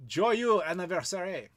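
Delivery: joyeux anniversaire said slowly in a clumsy put-on French accent; slow, careful, self-conscious French accent; bad French accent. Shown as a demonstration. Wishing a viewer happy birthday in a clumsy French accent. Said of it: "in my terrible, terrible accent".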